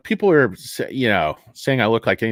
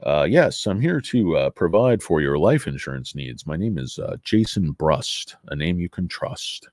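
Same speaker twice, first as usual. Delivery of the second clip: low raspy salesman; lightly voiced smoker with a sales pitch, pitched low; Jason Brust; 7 semitones lower than usual. Insurance seller introducing himself to a customer, low raspy voice. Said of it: "Jason Brust has a very raspy voice".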